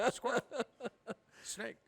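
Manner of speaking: ordinary talk, nothing marked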